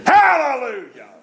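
high, drawn-out holler; jubilant praise shout. Retold or imitated. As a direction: shout praise like the overjoyed preacher, slowly stretched. Repeats the preacher's overheard praise shout.

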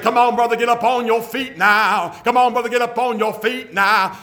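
high, loud revival-leader call; hollering at the man to stand up and dance; song leader calling out. Retold or imitated. Song leader urging the man with the broken hip to stand, loud call. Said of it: "he's like".

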